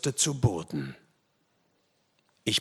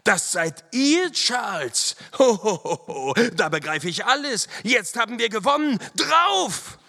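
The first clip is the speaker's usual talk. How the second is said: high, loud, triumphant outburst; jubilant shouting; 11 semitones higher, 4 dB louder than usual. A narrator's reading. Walker realising who Charles is, high jubilant shouting. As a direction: exclaim in delight, building to a battle cry. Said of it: "rief auch Walker".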